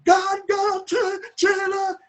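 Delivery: high, loud, terrified cries; shouting. Retold or imitated. Terrified neighbour mistaking police for gunmen, shouting. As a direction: keep crying out in terror, far above speaking pitch. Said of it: "she kept shouting".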